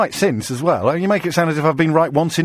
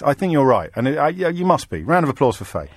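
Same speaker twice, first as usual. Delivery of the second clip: usual speaking voice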